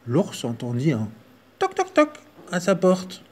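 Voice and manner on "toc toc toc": high-pitched knock knock. Acted. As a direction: tap it out high and light. Knocking sound effect.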